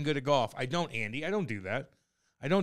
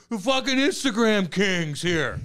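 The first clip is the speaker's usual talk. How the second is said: slow, raised, mock announcement; loud public taunt; 4 semitones higher, 6 dB louder, slower than usual. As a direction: imitate someone heckling for the whole room to hear. Retold taunt shouted before everybody.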